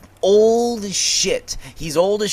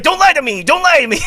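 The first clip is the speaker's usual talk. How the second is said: mock outraged shout; 11 semitones higher, 5 dB louder, faster than usual. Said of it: "But then he's like".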